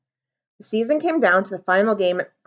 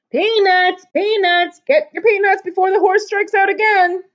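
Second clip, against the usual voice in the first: high, loud hawker's cry; loud high teasing vendor cry; peanut vendor call; 10 semitones higher, 4 dB louder than usual. Acted. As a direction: sell peanuts over the crowd. Vendor calling out in ballpark.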